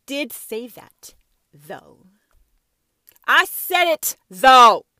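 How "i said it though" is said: shouted loud and higher, insisting; loud defiant yell. Real, not acted. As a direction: yell it, owning every word.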